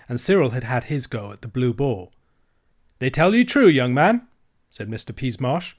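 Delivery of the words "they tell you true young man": higher, louder sly dealer; lightly voiced cocky tradesman; horse dealer.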